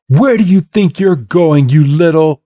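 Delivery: high indignant challenge; mock angry, cut off mid-insult; acted outrage. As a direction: berate a rival who is getting away. Blue angrily confronts Red.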